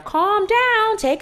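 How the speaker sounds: very high mocking squeak. Mocking the Xanax offer.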